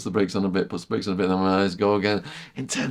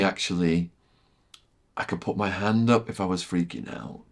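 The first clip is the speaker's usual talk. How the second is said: ordinary talk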